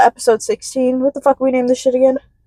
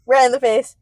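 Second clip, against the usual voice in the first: ordinary talk, nothing marked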